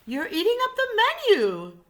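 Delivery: high and loud, scolding; alarmed waiter. Acted.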